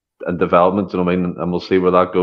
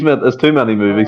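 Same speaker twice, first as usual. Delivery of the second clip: ordinary delivery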